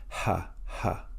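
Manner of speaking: "ha, ha" as two separate breathy syllables; "ha, ha" led by an audible puff of H; H sound. Shown as a demonstration. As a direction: demonstrate the H in "ha, ha", softly. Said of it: "note the addition of the H sound".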